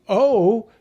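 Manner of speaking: loud, high long "O", lips rounding; long vowel O. Shown as a demonstration. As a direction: stretch "O" so the lips move. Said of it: "When the vowel says its name".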